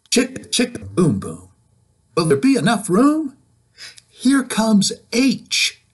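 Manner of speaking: put-on lively, sing-song read-aloud for kids; reading to children. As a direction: read it bouncy, like storytime. Reading picture book aloud.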